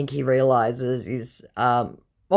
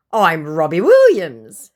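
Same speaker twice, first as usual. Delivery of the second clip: grand declaration; 5 semitones higher, 9 dB louder than usual. Retold or imitated. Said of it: "he declares".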